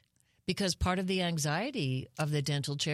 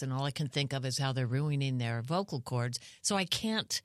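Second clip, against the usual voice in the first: ordinary delivery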